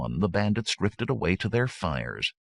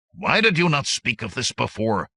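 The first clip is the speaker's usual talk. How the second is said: loud, raised challenge; demanding; 7 semitones higher, 5 dB louder than usual. A narrator's reading. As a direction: demand an explanation from him. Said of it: "Hordo demanded".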